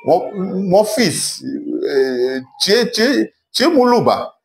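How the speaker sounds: high, halting quoted landlord; imitating the landlord. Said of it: "le bailleur m'a dit". (imitation du bailleur, voix plus aiguë)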